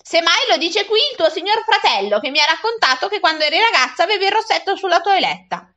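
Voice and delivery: high, spiteful self-defence; nettled woman firing back; increasingly vexed. A narrator's reading. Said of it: "sempre più indispettita".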